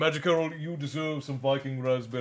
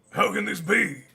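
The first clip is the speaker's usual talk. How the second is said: high and loud, stunned disbelief; astonished cry; 7 semitones higher, 6 dB louder than usual. Acted. Corgan, hearing the news, cries out in disbelief. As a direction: cry out in shock. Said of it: "Corgan said".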